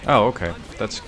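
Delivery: ordinary talk, nothing marked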